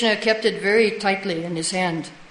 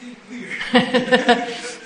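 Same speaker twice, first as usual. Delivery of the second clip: real amused laugh, slightly raised pitch; 3 semitones higher than usual. Real, not acted. Teacher reacting to a devotee's translation story, laughing. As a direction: laugh for a couple of seconds.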